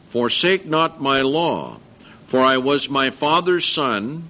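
solemn Bible reading voice; scripture reading. Acted. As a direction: read it to the congregation.

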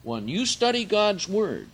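loud, high, slow preaching; full-force preaching. Acted. Preacher to the brethren, loud high preaching. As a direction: drive the words home to the brethren.

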